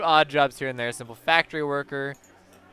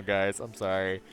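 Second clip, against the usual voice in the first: ordinary talk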